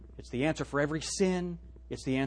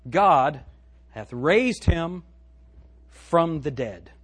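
slow scripture reading; 5 dB louder, much slower than usual. Acted. Solemn scripture read.

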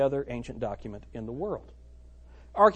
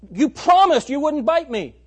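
put-on shocked girl; 9 semitones higher, 7 dB louder than usual. Bitten girl cries out.

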